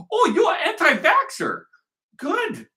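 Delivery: high, sneering mock of the commenter; mocking whiny voice.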